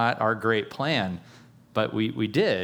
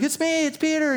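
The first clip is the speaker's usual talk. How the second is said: much higher, eager announcement; eager Peter. Retold or imitated. Peter naming himself to the servant girl, high eager. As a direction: call through the door, very high. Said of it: "he's like".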